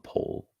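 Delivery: "pole" as one syllable, POHL; chosen pronunciation. Shown as a demonstration. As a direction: say "pole" with a silent final e. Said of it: "keep pronouncing it".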